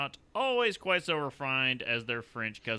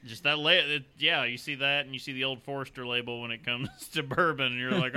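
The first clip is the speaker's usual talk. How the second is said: normal talking voice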